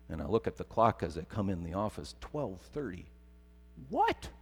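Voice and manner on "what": sudden high yelp of surprise; shocked what. Retold or imitated. Pastor seeing the clock, startled high yelp. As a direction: jolt up in pitch, startled.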